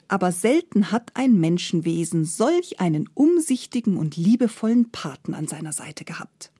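ordinary delivery

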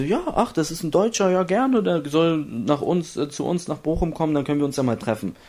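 high mock-excited voice, pitched well up; hammed-up happy club rep saying yes; eager team manager. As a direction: play the delighted club boss, bright and keen. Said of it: "Da meinten die so".

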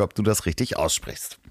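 normal talking voice

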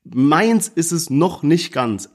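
ordinary talk

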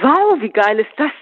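high excited squeal. A narrator's reading. Nursing student squeals at Paul's T-shirt, thrilled.

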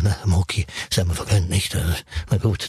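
low voice of the colleague; other doctor. Retold or imitated. Colleague answers the odd doctor, voice pitched low. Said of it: "Und dann sagt er".

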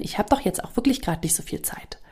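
soft whisper of a plausible excuse. Acted. Subconscious whispering a convenient excuse to you. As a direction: whisper it like a sly inner voice.

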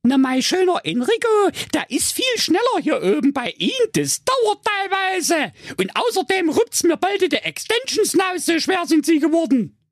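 Rapunzel. Acted. Rapunzel mocking the witch, high-pitched girl voice. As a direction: play Rapunzel high and sassy. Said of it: "Rapunzel sprach".

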